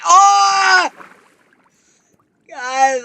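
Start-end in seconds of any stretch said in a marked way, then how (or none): 0.0-0.9 loud, high scream of fright; sudden long yell of alarm; scared scream. Real, not acted. Gamer startled mid-game, loud scared scream. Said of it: "I'm sorry for yelling".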